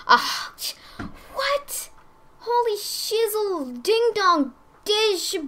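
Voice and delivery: high, slow squeal of amazement; can't believe what's in the chest; stunned gasp. Real, not acted.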